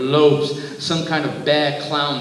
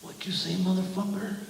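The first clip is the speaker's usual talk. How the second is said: very soft, higher-pitched threat; hushed menace; 6 semitones higher, 8 dB quieter than usual. A narrator's reading. Giant leaning down to the driver, hushed menace. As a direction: lean in with a dangerously quiet challenge.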